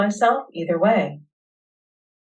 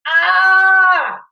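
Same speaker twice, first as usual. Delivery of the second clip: short high loud cry of frustration; loud frustrated shout; frustrated yell; 10 semitones higher, 6 dB louder than usual. Real, not acted. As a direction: let out a burst of frustration. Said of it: "if you need to yell or moan, let it out".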